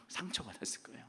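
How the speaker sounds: hushed, breathy aside; a soft whispered admission. Real, not acted. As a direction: whisper it as a confession. Preacher drops to a whisper.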